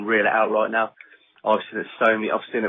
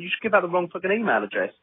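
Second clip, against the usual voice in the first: higher mock-exasperated imitation; mock bickering; 9 semitones higher than usual. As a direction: scold him like a nagging partner. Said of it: "they start bitching about it".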